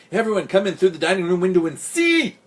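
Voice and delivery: high, loud cat call building to a long final word; excited cat summoning the others; excited mewing. A narrator's reading. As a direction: summon everyone with high-pitched urgency. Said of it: "mewing excitedly".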